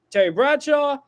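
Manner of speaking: normal talking voice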